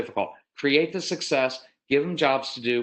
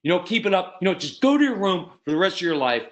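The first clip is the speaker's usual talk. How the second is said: acted higher, heated dad voice; escalating threats; 5 semitones higher, 3 dB louder than usual. Father sending son to his room, exasperated. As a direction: snap out an over-the-top punishment. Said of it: "I would escalate things".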